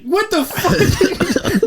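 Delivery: drawn-out, high-pitched mock shock with laughter; stunned disbelief. Man retelling his shock at crude family teasing, high laughing disbelief. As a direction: squeal it slowly, barely holding back laughs.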